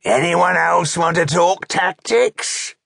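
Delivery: loud, slow orc taunt rising high; challenging orc chieftain. A narrator's reading. Orc chieftain glaring at the others, challenging them. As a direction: dare them loudly, climbing in pitch.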